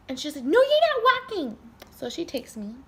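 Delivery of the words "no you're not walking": high, loud mock command; firm sister. Sister forbids the speaker to walk, loud high mock order. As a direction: put your foot down as the sister.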